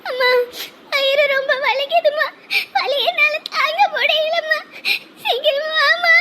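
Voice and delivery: high, tearful wail; sobbing, words stretched by pain; crying in pain. Acted. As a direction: plead with mother through the pain. Daughter tells mother of labour pain.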